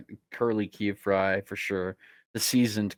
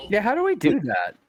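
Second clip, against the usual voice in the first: excited high question; 11 semitones higher, 3 dB louder than usual. Real, not acted. Eager question to game master.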